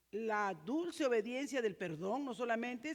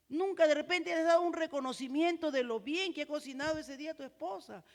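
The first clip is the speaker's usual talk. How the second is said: ordinary talk, nothing marked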